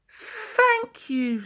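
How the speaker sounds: put-on relieved young diesel; Daisy the diesel. A narrator's reading. Daisy thanking.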